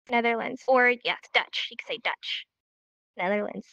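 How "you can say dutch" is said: unvoiced, much softer aside; whispered aside. Real, not acted. Teacher quietly adding the other word to the class, whispered. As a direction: whisper it as a side note.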